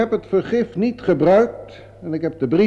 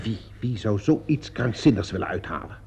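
hushed, rattled question; shaken disbelief; 6 semitones lower, 7 dB quieter than usual. Acted. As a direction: lower and softer, stammering on the first word. Suspect hears the murder theory.

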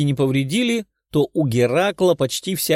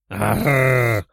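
put-on rolling growl of a dragon girl. A narrator's reading. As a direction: snarl into the punch. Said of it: "прорычала девушка-дракон".

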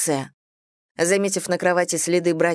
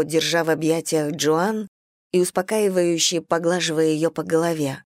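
ordinary delivery, nothing marked

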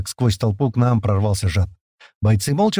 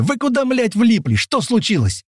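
high, agitated yelling; yelled questions; 12 semitones higher than usual. A narrator's reading. April running up to his friend, yelled questions. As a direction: demand it at a high pitch. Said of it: "заорал Апрель, подбегая ко мне".

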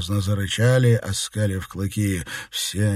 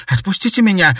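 breathless cry; 10 semitones higher, 7 dB louder, faster than usual. A narrator's reading. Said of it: "выдохнула Бьянка".

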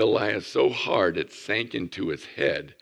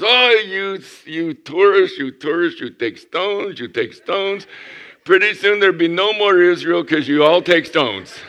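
higher and louder, exasperated official scolding; scolding customs agent; 8 semitones higher, 7 dB louder than usual. Retold or imitated.

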